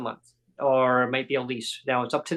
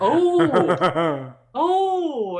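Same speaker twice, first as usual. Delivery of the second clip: high, exaggerated "oh"; hammed-up dawning realization, pitched way up; mock surprise; 9 semitones higher, 6 dB louder than usual. Tenant learns she really is moving, mock surprise.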